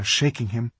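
ordinary delivery, nothing marked

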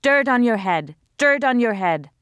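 loud, high angry yelling. A narrator's reading. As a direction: yell it up the stairs. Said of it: "Mariam was still yelling".

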